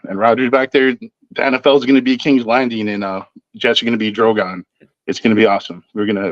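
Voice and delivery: ordinary delivery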